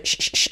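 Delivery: short unvoiced "sh" bursts; hushing "sh" sound, repeated crisply; SH demo. Shown as a demonstration. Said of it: "es S H".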